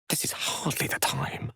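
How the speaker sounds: sharp hushed whisper. Acted. Wizard scolding a companion quietly, whispered. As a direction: hiss it out of earshot.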